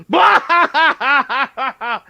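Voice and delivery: loud burst of mock cackling; cackling hand. Game master as the hand that looks up, loud cackle. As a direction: break into a big staccato villain laugh. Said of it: "The hand looks up and goes".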